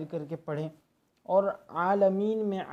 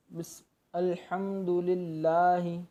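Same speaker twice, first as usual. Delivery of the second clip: recitation demo. Shown as a demonstration. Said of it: "देखिए यहाँ पर आप ध्यान रखें".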